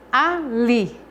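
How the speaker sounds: teacher showing the strong "li" in "Ali"; stress on li. Shown as a demonstration. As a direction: hit the last syllable of "Ali". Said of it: "porque é a que eu pronuncio com mais força".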